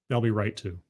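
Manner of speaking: a dropped-voice afterthought; quiet aside. Acted. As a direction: mutter it again to yourself. Punchline then repeated aside.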